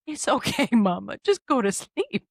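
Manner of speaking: put-on high, loud little-kid reassurance; toddler voice. Mom voicing toddler telling her to sleep, high kid voice. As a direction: soothe mama like a toddler would. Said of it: "And she goes".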